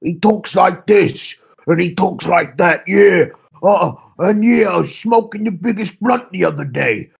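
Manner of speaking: hammed-up Joey Diaz, shouting the punchlines; Joey Diaz impression. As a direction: boom it out like a rowdy comic telling a stoner story. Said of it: "who Joey Diaz is".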